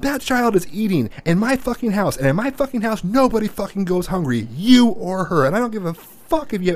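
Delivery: high-pitched imitation of an angry father; retold furious rant. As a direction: mimic dad going off in his house. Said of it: "He's like".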